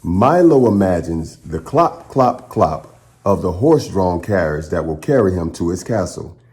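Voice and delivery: put-on steady, clear read-aloud voice for children; story narration. Host reading a picture book to the class, in a clear storytelling voice.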